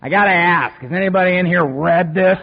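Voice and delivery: put-on loud blunt old-timer asking the room; gruff loud question. Old-timer Mike challenging newcomers at meeting, loud blunt voice. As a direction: bark it out to the whole room.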